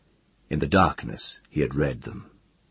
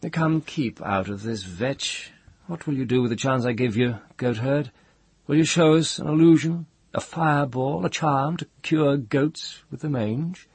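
high, mocking rival's voice; sneering Jasper; 10 semitones higher, 3 dB louder than usual. A narrator's reading. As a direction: taunt him with lofty scorn.

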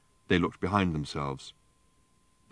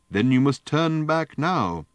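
stern, slow and a bit louder; severe; 7 semitones higher, 5 dB louder, slower than usual. A narrator's reading. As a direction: lay down the rule sternly. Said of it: "said the little lady severely".

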